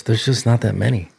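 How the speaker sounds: ordinary delivery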